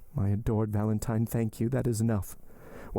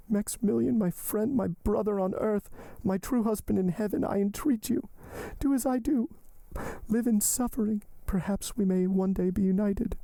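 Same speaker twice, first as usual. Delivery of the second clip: much higher, pleading heroine voice; desperate young woman; 12 semitones higher than usual. Acted. Heroine entreating her lover to live, high and pleading. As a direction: lift the voice and entreat him.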